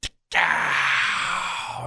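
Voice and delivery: high, sharp shouted tick-tow echo; ringing imitation of a far-off rifle crack; mimicked gunshot. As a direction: call out the shot sound like it carries across the woods. Imitating distant rifle shots at dawn.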